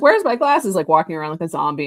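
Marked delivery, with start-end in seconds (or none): 0.0-0.7 higher, louder ditzy lost-glasses cry; Velma voice. Retold or imitated. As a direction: play a blind girl groping for her glasses. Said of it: "She'd be like".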